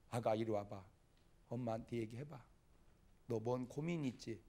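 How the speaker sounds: put-on soft, tender mother coaxing her child; earnest mother. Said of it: "진지하게 이렇게 얘기해서". (아이를 달래는 부드러운 엄마 목소리)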